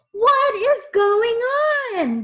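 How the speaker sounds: high, loud, slow shout; boy yelling in confusion; as loud as he could. A narrator's reading. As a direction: bellow the question across the room. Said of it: "as loud as he could".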